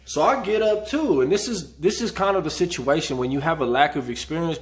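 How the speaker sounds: usual speaking voice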